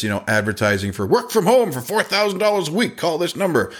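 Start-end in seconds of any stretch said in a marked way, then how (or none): 1.1-3.7 high, salesy huckster voice; mock scam ad. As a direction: parody a sketchy work-from-home ad. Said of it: "You sound like somebody that's".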